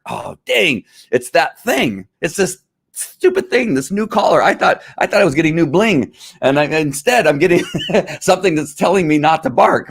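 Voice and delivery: high, put-on whiny dog voice; complaining dog. Dog complaining about collar.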